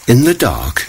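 a worried mother's question; gentle surprised mother; mother cricket. Acted. Mother cricket questions her son.

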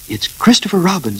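high, eager cry. A narrator's reading. Pooh spots Christopher Robin, eager. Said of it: "said Pooh eagerly".

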